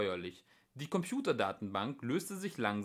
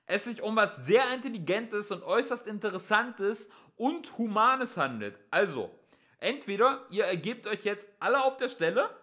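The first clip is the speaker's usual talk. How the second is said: high, raised ultimatum; shouted, reasonable-sounding threat; shouting; 8 semitones higher, 5 dB louder than usual. A narrator's reading. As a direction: lay out the terms loudly across a distance. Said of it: "schrie der Polizist".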